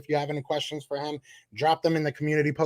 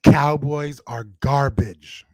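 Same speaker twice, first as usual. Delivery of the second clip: slow, deliberate mic-check taunt; slow test line; 2 semitones lower, 5 dB louder, much slower than usual. Shown as a demonstration. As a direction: drag each word out for the chat. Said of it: "Is this thing working? Can you hear me?".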